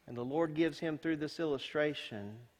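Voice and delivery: ordinary talk, nothing marked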